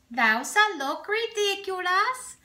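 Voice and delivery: put-on high, slow, sing-song Danish accent; mock Danish accent. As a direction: play it up in a silly Danish accent. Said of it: "that was a Danish accent".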